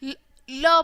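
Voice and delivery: ल begun as a bare "l", then "luh"; phonics sound. Shown as a demonstration. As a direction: sound out ल for young learners. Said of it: "L की sound कैसे निकलेगी". (ल की ध्वनि अलग करके बोलें)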